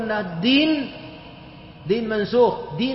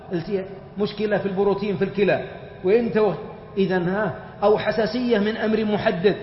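ordinary talk

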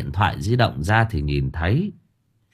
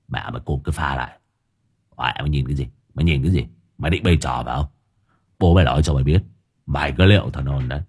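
low, gruff voice berating the dog; gruff scolding; 6 semitones lower, faster than usual. A narrator's reading. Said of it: "ông ổng chửi con mực". (giọng trầm ông ổng chửi chó)

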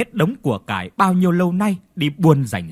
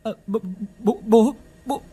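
terrified stammer; 8 semitones higher than usual. Acted. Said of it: "ú ớ".

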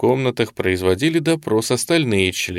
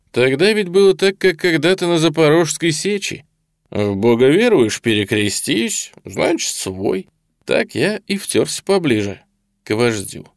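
jovial storytelling with pitched-up opening; chatty interrogator colonel; 8 semitones higher, 3 dB louder than usual. A narrator's reading. Colonel telling how he infiltrated the Vlasovites, chatty. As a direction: tell it like an amusing old anecdote.

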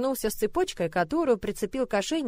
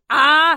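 loud threatening cry, less high; menacing yell; 6 semitones higher, 11 dB louder than usual. A narrator's reading. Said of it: "снова грозное". (грозный громкий крик)

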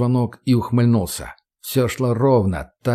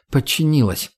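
usual speaking voice

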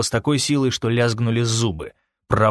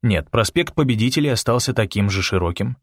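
plain reading voice

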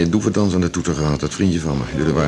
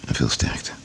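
hushed sympathy; 6 dB quieter, slower than usual. Acted. Parting condolence to grieving Olga.

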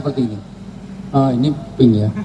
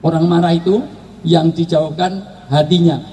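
usual speaking voice

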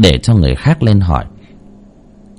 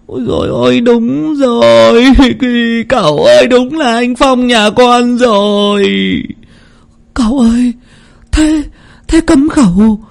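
much higher, slowed wailing lament; tearful lament; 14 semitones higher than usual. Acted. Lan crying out to her dead brother Phong. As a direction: wail for the lost brother. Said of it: "nước mắt rưng rưng".

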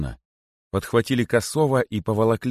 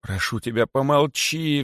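frightened plea, voice lifting at the end; terrified pleading; 7 semitones higher than usual. A narrator's reading. Kosoy begs the rabbit in his belly, pleading in terror. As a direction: beg for silence in dread. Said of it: "взмолился Косой, холодея от ужаса".

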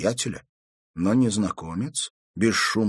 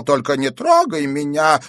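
higher, louder scared plea; frightened wailing; 6 semitones higher, 6 dB louder than usual. A narrator's reading. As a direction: beg not to be hurt. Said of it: "испуганно запричитал". (испуганные причитания)

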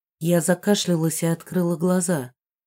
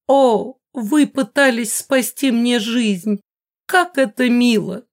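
high, loud, effusive lady; gushing mother; 6 semitones higher, 5 dB louder, slower than usual. A narrator's reading. Mother thanks мистер Джоун for shielding her, loud gushing. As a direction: gush grandly, drawing the words out.

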